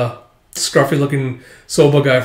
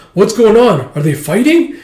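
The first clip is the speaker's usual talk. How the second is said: higher, louder excited questions; excited onlooker; 8 semitones higher, 5 dB louder than usual. Acted. Onlooker reacts to a fight breaking out, excited. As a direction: call out like a startled bystander.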